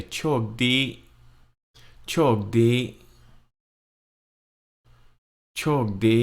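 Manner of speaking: โชคดี said clearly three times; model phrase. Shown as a demonstration. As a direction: repeat โชคดี clearly for learners. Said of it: "let's just say".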